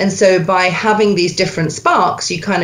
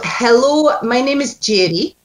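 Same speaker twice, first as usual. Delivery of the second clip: Scots "Hello, my name is Jerry", pitched up; Scots accent; 4 semitones higher than usual. Shown as a demonstration. Coach leading actor through Scots spark line.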